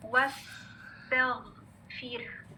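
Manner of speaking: drawn-out recitation, each sound held; slow Quranic recitation model; slow careful recitation. Shown as a demonstration. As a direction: recite the word very slowly, as a model. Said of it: "आगे देखिए next word".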